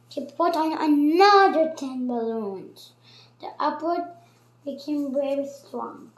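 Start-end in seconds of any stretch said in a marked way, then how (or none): none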